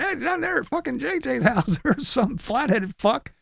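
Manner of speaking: high, whiny tattletale voice; nosy neighbor reporting the trailer to the HOA, high whiny voice; mock tattling neighbor. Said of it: "and be going".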